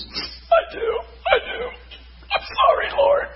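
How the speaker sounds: imitated tearful wail, very high; mock crying in a thin, high voice; bawling. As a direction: squeak out a sobbing apology as the wife. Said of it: "she's bawling".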